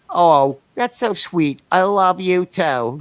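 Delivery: put-on slow, nasal-free New York nanny accent; imitating a New York girlfriend. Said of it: "your girlfriend sounds just like the nanny".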